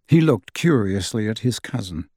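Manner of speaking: usual speaking voice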